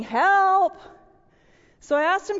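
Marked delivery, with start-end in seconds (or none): 0.0-0.8 high, loud mock call for help; cheerful call for help. Smiling son in the drawing, mock call for help. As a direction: call out happily as if drowning. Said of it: "And he's calling".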